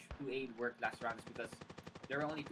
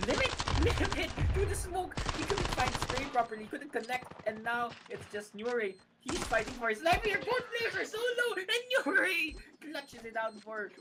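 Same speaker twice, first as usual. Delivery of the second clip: excited shouting commentary; screaming; 10 semitones higher, 7 dB louder than usual. Real, not acted. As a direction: yell the clutch at full voice. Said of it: "I'm screaming so loud".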